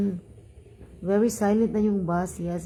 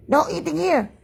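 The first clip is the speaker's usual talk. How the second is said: higher-pitched mock shout; imitated scolding; 6 semitones higher than usual. Driver scolding a passenger eating cracklings, mock shout. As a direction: imitate a driver telling someone off. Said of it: "Ganun siya".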